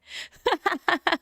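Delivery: high, delighted burst of laughter; happy laugh. Real, not acted. Laughing after winning.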